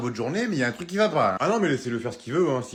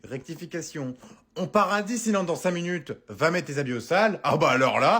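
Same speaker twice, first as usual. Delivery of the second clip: usual speaking voice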